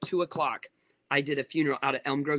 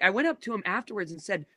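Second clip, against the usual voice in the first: ordinary talk, nothing marked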